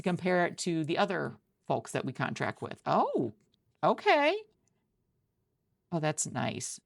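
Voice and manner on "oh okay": a sudden high, loud "okay" of surprise; pleasantly surprised. Acted. Recruiter leafing through a portfolio, pleasantly surprised. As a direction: caught off guard by something good.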